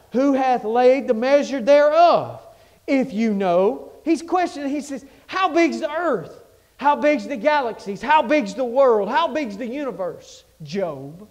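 loud questioning preacher's call; preaching. Acted. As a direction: press each question on the crowd.

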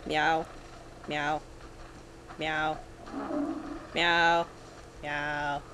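low, soft human meow; pretend meowing. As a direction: meow softly, low and monotone. Mimics cat to the cat.

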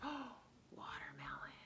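breathy gasped whisper; gasp, then whisper it like a discovery. Retold or imitated. Kid excitedly finding watermelon, gasped whisper.

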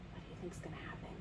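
quick, soft whisper; hushed aside. Real, not acted. Storyteller asks listeners before the splash, hushed whisper. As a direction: whisper the question in suspense.

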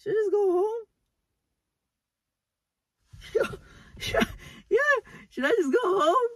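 high, squeaky crying voice; tearful wail. Real, not acted. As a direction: whimper the question through tears. Tearful, scared alone at night.

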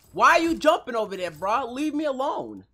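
loud, high, fed-up yelling at another player; really heated, yell it at him. Real, not acted. Streamer yelling at a player jumping around him, angry and loud. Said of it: "like, heated".

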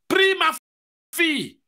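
high ringing call to pray; the Lord calling. Retold or imitated. The Lord wakes his daughter, high ringing call. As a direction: call her up out of sleep.

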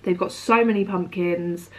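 usual speaking voice

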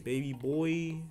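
ordinary talk, nothing marked

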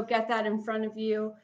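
normal talking voice

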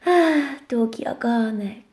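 rozmarzony szept. Acted. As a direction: wyszeptaj to z zachwytem. Rozmarzenie o ogonku. (szept rozmarzonej owieczki)

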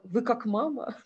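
ordinary talk

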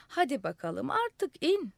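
calling out. A narrator's reading. Crowd urging the madman to come down, calling out. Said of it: "diye bağırdılar".

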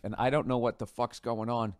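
ordinary talk, nothing marked

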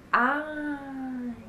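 long fading ai; intonation demo. Shown as a demonstration. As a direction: let "ai" trail away slowly. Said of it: "Também tem várias entonações".

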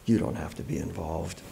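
low, hushed repeat of her words; quiet echo. Acted. Narrator echoing the neighbour's offer, low and quiet. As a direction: drop the pitch and say it softly.

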